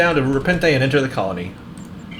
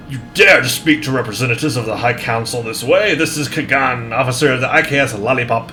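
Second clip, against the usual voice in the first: imperious British officer; haughty British commander; British accent. Acted. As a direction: lay down the law in British accent. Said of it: "I've turned British".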